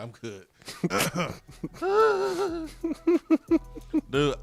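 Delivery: ordinary talk, nothing marked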